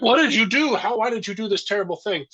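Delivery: high-pitched aghast questioning; hammed-up retold horror; mock outrage. Acts out whole family aghast.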